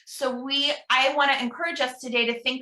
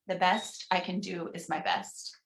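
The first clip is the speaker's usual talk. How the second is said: softer, murmured repeat; soft responsive echo; 3 semitones lower, 7 dB quieter than usual. Shown as a demonstration. Minister quietly repeating the affirmation with the congregation, softer. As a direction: echo it gently, as the congregation would. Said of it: "pause and encourage you at home".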